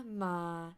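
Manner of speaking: "ma" held low. Shown as a demonstration. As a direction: drop "ma" below normal pitch. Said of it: "these are the five Thai tones".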